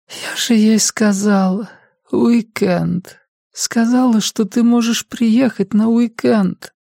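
high, slow voice of an ailing mother; each phrase pushed out with effort; labored speech. A narrator's reading. Sick mother protests son's early visit, speaking with effort. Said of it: "Каждое слово давалось ей с трудом".

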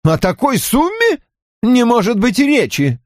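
high-pitched, indignant refusal; emphatic refusal. A narrator's reading. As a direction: cut the offer dead, pitch leaping up. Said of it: "отрезал он".

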